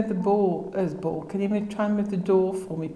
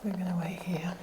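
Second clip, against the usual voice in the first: whispering; 10 dB quieter than usual. Real, not acted. Quiet waiting in dark room.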